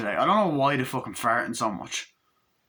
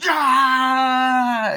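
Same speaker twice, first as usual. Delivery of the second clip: high, loud horror-movie shriek; Chucky scream; 13 semitones higher, 9 dB louder than usual. Retold or imitated. Retelling the Chucky movie, his loud shriek. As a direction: let out Chucky's piercing scream.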